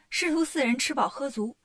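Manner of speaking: plain reading voice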